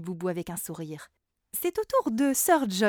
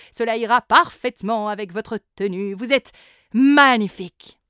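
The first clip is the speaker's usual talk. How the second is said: flamboyant salesman; 9 dB louder than usual. A narrator's reading. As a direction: gush like a fashion dealer. Same seller continues. (vendeur extravagant, très fort)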